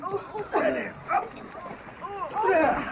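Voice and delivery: loud strained push as the weight comes up; effort. Acted. Rescuer pulling a woman from the water, straining loud. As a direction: heave her up onto the quay with a grunt.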